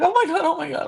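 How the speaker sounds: high-pitched imitation of a horrified group; mock panic. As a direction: squeal it like startled onlookers. Retelling everybody's reaction to the scream.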